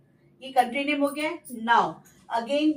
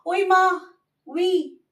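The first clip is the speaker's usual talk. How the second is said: louder, higher mock ouch cry; startled exclamation; 5 semitones higher, 4 dB louder, much slower than usual. Teacher mimics a startled cry to show ü sound. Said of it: "जैसे बोलते हैं".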